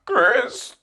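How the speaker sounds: sudden high, strained answer; voice jumps up. Retold or imitated. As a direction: squeak the name up high, nervous. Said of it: "what happened to your voice".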